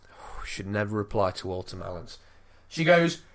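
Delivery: ordinary delivery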